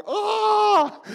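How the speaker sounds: very high, very loud yelp of alarm; own shocked cry. Retold or imitated. Preacher reenacting his own startled shout. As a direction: cry out as the bus strikes. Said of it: "And I was like".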